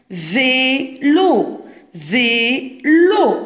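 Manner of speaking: "Vélo" drawn out as two separate syllables. Shown as a demonstration. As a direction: stretch both halves of "Vélo" for pupils listening. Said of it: "سمعناها في le deuxième syllabe". ("Vélo" مقطع بمقطع وبمد)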